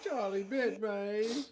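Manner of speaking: put-on high, slow British child whine; British kid. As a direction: draw it out like a whining British toddler. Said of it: "I think it's a British kid though".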